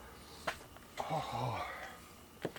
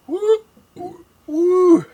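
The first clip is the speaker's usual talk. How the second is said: loud high whoops from the heat; burning woo; 10 dB louder, much slower than usual. Real, not acted. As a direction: whoop through the burn. Hot sauce burn kicks in.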